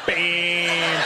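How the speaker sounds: long drawn-out word in a mock Indian accent. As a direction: stretch out the parroted Indian accent. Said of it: "talk like this for half an hour".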